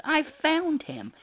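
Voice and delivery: put-on high, slow insistent kid; child voice. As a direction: drag it out, stubborn kid. Kids arguing over rabbit.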